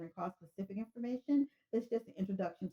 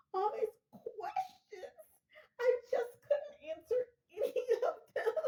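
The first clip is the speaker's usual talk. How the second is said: hysterical caller; 12 semitones higher than usual. Acted. Caller to the operator, high and laugh-sobbing in mock distress. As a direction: fall apart on the phone, laughing through mock tears.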